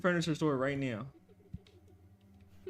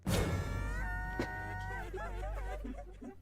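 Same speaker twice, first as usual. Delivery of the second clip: high breathless laugh-cry; breathless fright; 11 dB quieter than usual. Real, not acted. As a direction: laugh helplessly in panic.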